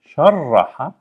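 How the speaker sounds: "sharraha" with a long trilled r; doubled r. Shown as a demonstration. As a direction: stress and hold the r in "sharraha". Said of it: "the intervention of the stress of the shadda".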